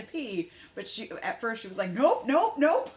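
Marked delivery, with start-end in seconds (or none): 1.9-2.9 high, loud, rapid-fire refusal; punchy comic nopes, pitched up; balking dog. Retold or imitated. Voices dog refusing the cold.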